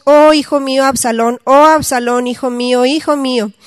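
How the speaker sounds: loud, solemn lament read out; crying aloud. A narrator's reading. David cries out for his dead son, loud lament. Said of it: "clamaba en alta voz".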